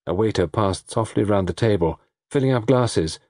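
usual speaking voice

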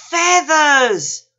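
high, loud, drawn-out cry; excited whoop. A narrator's reading. Panda admires the peacock, excited whoop. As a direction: whoop it out with delight. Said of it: "Panda whooped".

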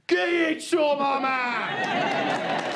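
very high, drawn-out shout; granddad shouting. Retold or imitated. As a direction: bellow across the lawn to cheer him on. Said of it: "he shouted".

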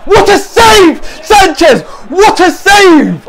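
loud, high cheer for the save; elated shout. Real, not acted. Fan cheering the keeper's save, elated shout.

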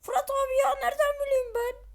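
high, loud crying protest; whiny robot voice. Pretend. Robot whines back at Fırat, high and tearful. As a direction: whine it out like a hurt sidekick.

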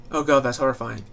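ordinary talk

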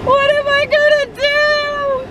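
high, slow wailing lament; mock despair. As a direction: ham up the despair. Dad hams up despair over school.

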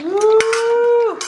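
high delighted whoop; excited whoop. Real, not acted. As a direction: cheer for the dog. Dog drinks the milk.

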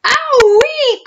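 very high, loud yelp of pain; crocodile's howl of pain; pained cry. A narrator's reading. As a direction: squeal as the hippo hits. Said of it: "cried the crocodile".

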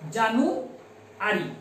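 January drawn out slowly, syllable by syllable; slow spelling-style January. Shown as a demonstration. Teacher slowing January down for spelling. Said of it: "like that".

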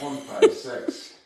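high, slow laughing count. Real, not acted. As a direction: count through a giggle. Laughing after teasing.

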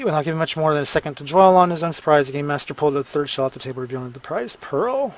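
rattled off quickly; fast re-read. Shown as a demonstration. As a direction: speed through the revised line. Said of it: "let's see".

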